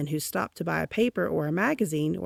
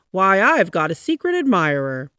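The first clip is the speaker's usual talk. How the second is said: louder delighted exclamation; exclaiming; 9 dB louder than usual. A narrator's reading. Lonely man realising he has an admirer, loud delight. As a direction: burst out with joyful discovery.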